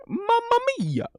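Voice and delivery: very high hammed-up Italian mamma mia; jokey Italian exclamation; mock Italian. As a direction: play a cartoon Italian.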